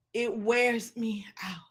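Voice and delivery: whispered, worn out and slow; tired whisper. Real, not acted. Preacher to her listeners, a weary whisper. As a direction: let it drain out of you in a whisper.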